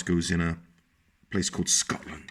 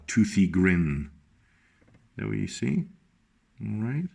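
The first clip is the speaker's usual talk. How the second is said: ordinary delivery, nothing marked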